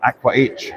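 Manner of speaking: "Aqua H" with the final letter named, AK-wuh aitch; spelled-out ending. Shown as a demonstration. Vlogger listing disputed brand pronunciations, "Aqua H" said with aitch. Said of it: "some people calling it Aqua H".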